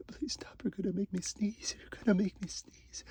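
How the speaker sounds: ordinary delivery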